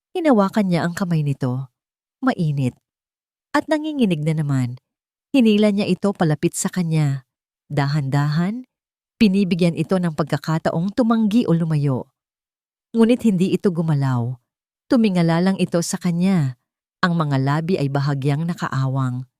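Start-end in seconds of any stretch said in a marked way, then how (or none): none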